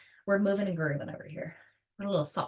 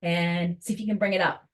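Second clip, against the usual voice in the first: ordinary talk, nothing marked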